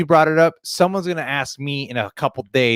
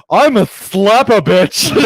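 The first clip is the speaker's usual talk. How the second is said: sassy campy voice, higher and louder, slowed; 7 semitones higher, 6 dB louder, slower than usual. Retold or imitated. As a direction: camp it up like a sassy drag queen.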